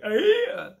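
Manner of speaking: high, loud mock Geordie; bad Geordie accent. Comic sounding like a moron, bad Geordie accent. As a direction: shoot it up in exaggerated Geordie. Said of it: "everyone loves doing a Geordie".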